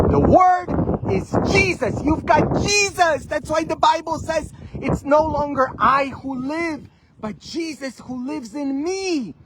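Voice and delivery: high, loud, impassioned proclamation; full-force preaching. Real, not acted. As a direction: cry it out to the congregation.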